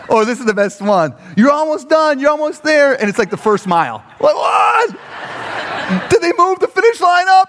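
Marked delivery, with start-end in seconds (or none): 1.4-3.0 high-pitched spectator encouragement; mock cheery yell; yelled cheering. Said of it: "the whole time yelling". 4.4-7.5 very high, shouted astonishment; exasperated runner, voice way up; outraged disbelief. Acted.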